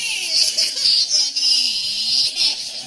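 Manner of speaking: loud crying laugh; half sob half laugh; wailing laugh. Retold or imitated. Comic imagining his own over-the-top reaction, loud.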